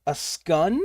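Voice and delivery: scone said as scun, short u vowel. Shown as a demonstration. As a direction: say scone rhyming with nun, scun. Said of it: "like in nun?".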